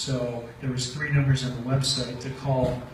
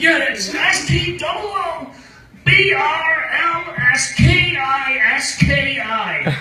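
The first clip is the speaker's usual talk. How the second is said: much higher, slow sing-song spelling; drunk stranger; 12 semitones higher, 6 dB louder, slower than usual. Retold or imitated. Drunk stranger spelling his name again, high and sing-song. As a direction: chant the letters like a hammered guy.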